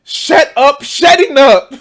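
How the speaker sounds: a mock Tucker Carlson snapping at his guest; rising mock exasperation, hammered out; Tucker losing patience. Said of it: "to his just like".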